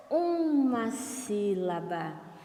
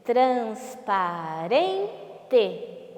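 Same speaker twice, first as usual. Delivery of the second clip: syllable by syllable; much slower than usual. Shown as a demonstration. Teacher has pupils count syllables, "Transparente" split very slowly. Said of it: "Vamos ver".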